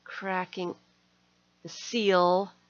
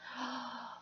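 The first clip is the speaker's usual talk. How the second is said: gasp; 3 semitones higher, 13 dB quieter than usual. Real, not acted. Unboxing a gift, surprised.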